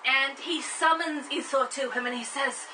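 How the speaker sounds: ordinary talk, nothing marked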